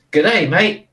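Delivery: put-on failed Aussie accent that slips American; "G'day, mate" in a mock Aussie twang; Australian accent. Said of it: "They sounded quite American".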